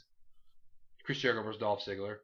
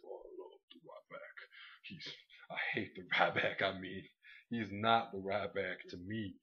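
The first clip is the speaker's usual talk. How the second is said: lightly imitating Mark Henry, slow and low-key; a hint of Mark Henry's slow delivery; mock Mark Henry voice; 3 semitones lower, 4 dB quieter than usual. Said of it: "why are you talking like you're Mark Henry".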